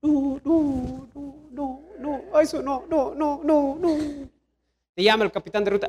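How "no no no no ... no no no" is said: high, repeated hammed-up no's; mock reluctant refusal. Believer told to attend church more, mock reluctant refusal. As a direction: imitate someone squirming out of it.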